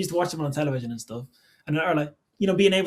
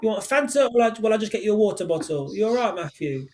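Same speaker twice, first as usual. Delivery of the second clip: higher-pitched London accent; 5 semitones higher than usual. Shown as a demonstration. Guest demonstrating London, asking Matthew about dinner, London accent. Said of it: "that's my, my London one".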